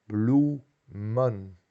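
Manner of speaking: "blumen" with a long "oo", in two syllables. Shown as a demonstration. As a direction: sound out "blumen" slowly as bloo-men. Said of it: "es U".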